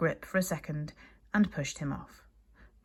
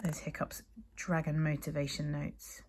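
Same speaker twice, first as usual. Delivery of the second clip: quiet aside; 8 dB quieter than usual. Real, not acted. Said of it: "teacher aside to class".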